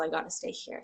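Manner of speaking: ordinary delivery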